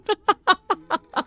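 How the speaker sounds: rapid staccato high laughter; helpless high-pitched giggling; high cackling laugh. Real, not acted. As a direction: burst into a high, stuttering laugh. Amused by game text.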